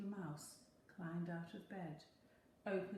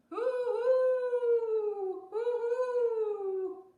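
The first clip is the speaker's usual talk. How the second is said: long high hoots, much louder; loud high hoo-hoo owl call; owl hooting; 14 dB louder, much slower than usual. A narrator's reading. Owl calling in the night.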